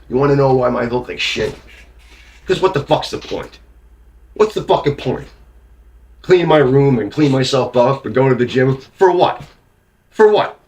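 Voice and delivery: high, loud bitter yelling; angry yelling. Real, not acted. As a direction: yell it, furious and despairing. Rant rising into yelling.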